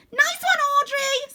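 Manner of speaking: very high, loud squeal; gleeful little girl squealing. Acted. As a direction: squeal with glee. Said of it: "squeals a voice".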